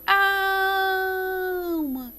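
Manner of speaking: ALMA with a very long, high first syllable; stressed syllable stretched. Shown as a demonstration. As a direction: call out AAAL-ma, holding the AL. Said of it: "Qual é a sílaba tônica de alma?".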